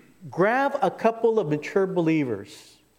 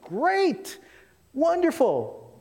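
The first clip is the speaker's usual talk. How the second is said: pitched way up, slow and beaming; high drawn-out delight; 11 semitones higher, much slower than usual. Retold or imitated. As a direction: sing out Great, Wonderful, stretched. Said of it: "And you're like".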